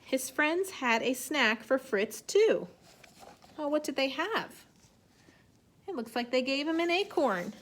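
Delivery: slow, clear picture-book reading; reading to children. A narrator's reading. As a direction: read it gently to young kids. Teacher reads story aloud.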